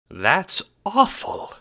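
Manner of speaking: very high, slow, pitying voice; sympathetic mayor. A narrator's reading. Mayor consoling the renegade, sympathetic. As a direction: commiserate slowly in a high little voice. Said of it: "placing a sympathetic hand on your shoulder".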